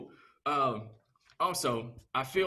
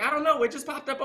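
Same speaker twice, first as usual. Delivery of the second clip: high, loud, innocent protest; wife's innocent excuse; 10 semitones higher, 3 dB louder than usual. Retold or imitated. Wife caught with her phone, high loud innocent protest. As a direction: play dumb, voice jumping up high and loud. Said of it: "She's like".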